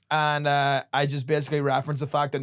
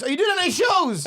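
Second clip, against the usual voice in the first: high, loud eager fan voice; 5 dB louder than usual. Retold or imitated. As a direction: ask like an overeager fan. Fan asks from car.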